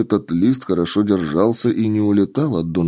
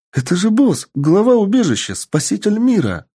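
high, eager and proud; excited elf girl; 12 semitones higher than usual. Acted. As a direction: gush about your hero, high and bright. Elf proudly introduces the boss.

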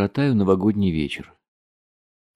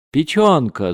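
higher, loud shouted word; yelling; 5 semitones higher, 6 dB louder, slower than usual. A narrator's reading. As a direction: yell it out as an answer. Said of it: "заорал я". (заорать, громко выкрикнуть)

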